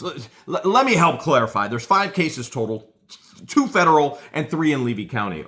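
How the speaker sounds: high, loud mocking stammer; mock stammering lawyer. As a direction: stumble in like a pompous lawyer. Mocking Saran Wrap's clarification.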